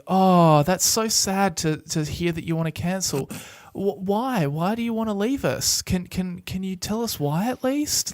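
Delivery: high pleading sad customer service voice; guilt-trip rep voice. Retold or imitated. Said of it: "And you'd be like".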